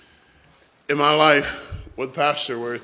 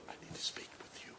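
whispered warning, very soft; stern whisper; 25 dB quieter, faster than usual. Retold or imitated. Pastor warning the boys mid-service, very soft whisper. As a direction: hiss it across the room, barely voiced. Brother Bush warning from the platform.

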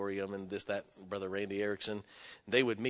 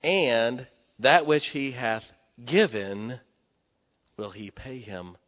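scripture reading; 7 dB louder, slower than usual. Acted. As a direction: read the verse with weight, letting it fade. Reads Proverbs verse from pulpit.

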